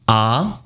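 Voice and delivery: AH sliding upward; rising second tone. Shown as a demonstration. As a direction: lift the pitch through "ah". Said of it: "Second tone rising".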